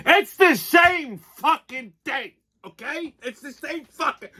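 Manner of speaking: slow, high-pitched exasperated outburst; exasperated rant. Real, not acted. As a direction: spit it out in disbelief. Ranting mockery of liberal word games.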